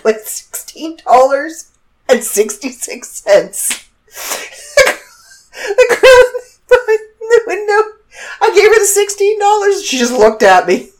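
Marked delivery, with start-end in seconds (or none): 4.1-9.8 high, laughing through the words; words breaking into laughter; laughing while telling. Real, not acted. Telling a friend the funny drive-thru story, laughing.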